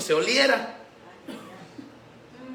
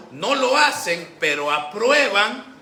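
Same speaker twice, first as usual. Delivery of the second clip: higher, louder, slowed on "no lo hacen, pero aprueban"; emphatic contrast; 3 semitones higher, 5 dB louder, slower than usual. Real, not acted. Preacher warning the church about approving sin, slow loud emphasis. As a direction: punch each word of "no lo hacen, pero aprueban".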